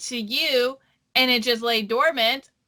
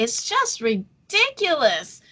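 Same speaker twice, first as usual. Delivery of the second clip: ordinary talk, nothing marked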